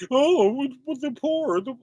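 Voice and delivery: high, sneering mock voice; mocking the poor. Preacher mimics a scoffer mocking the poor, high and sneering. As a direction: sneer it like someone looking down on the poor. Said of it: "somebody over there mocking".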